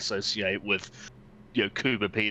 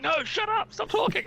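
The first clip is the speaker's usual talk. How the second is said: high yell. Retold or imitated. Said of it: "grabbing the microphone and going".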